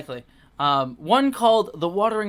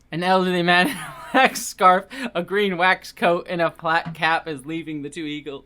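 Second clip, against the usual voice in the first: ordinary talk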